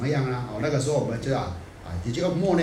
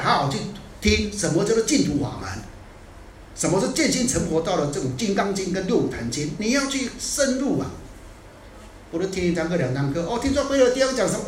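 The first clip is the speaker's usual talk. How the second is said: ordinary talk, nothing marked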